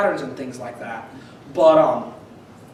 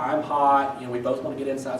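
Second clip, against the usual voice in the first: ordinary talk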